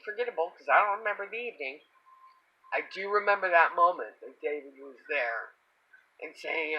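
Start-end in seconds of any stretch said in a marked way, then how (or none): none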